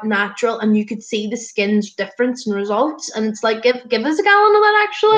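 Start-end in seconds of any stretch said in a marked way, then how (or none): 3.9-5.2 much higher, gushing; high gushing delight; excited. Real, not acted. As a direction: squeal for a gallon of it. Enthusing over skin results.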